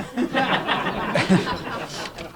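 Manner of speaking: soft unvoiced laugh; breathy laugh. Real, not acted. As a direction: chuckle at the punchline. Laugh after joke story.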